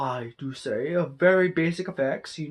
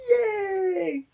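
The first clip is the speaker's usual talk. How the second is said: a high squeaky sarcastic yay; mock cheer. Mock cheer after complaining about suspects.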